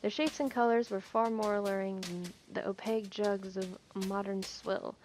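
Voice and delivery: usual speaking voice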